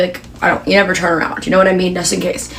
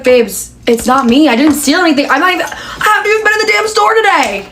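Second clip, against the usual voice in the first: exasperated protest; 6 semitones higher than usual. Retold or imitated. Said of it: "And I was like".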